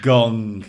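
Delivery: a loud, booming imitated gong; gong sound effect. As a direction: boom it out like the gong before the Undertaker's entrance. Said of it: "board where you can press the, the sound effects".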